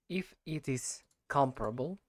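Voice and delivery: usual speaking voice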